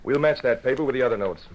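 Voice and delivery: ordinary delivery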